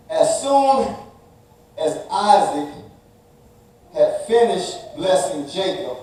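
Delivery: measured, emphatic Bible reading; scripture reading. Acted. Preacher reading Genesis aloud to the congregation, measured and emphatic.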